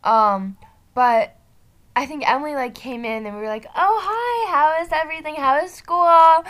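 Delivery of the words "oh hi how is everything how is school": awkward. Retold or imitated. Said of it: "It was so awkward".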